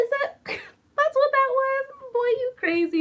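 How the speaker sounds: high, loud voice broken by laughter; teacher laughing at the boy's surprise friendship game; laughing disbelief. Retold or imitated. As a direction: giggle through it, teasing fondly.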